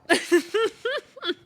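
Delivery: high, loud cackle near tears; a shrieking burst of laughter; laughing shriek. Real, not acted.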